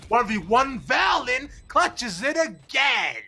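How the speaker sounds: high, loud, slow yell; hype clutch call. Real, not acted. Caster calling a clutch.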